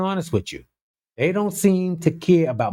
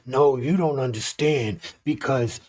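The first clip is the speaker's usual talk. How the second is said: mock strained voice, softer than usual; Robert F. Kennedy Junior; 2 semitones lower, 5 dB quieter than usual. Host mimicking the health chief last week, strained soft voice. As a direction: imitate a labored, hard-to-hear speaker. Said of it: "He's talking like that".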